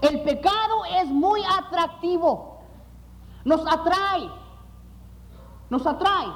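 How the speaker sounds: earnest preaching. Acted. Pastor preaching to his congregation, earnest sermon voice. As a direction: preach it to the hermanos.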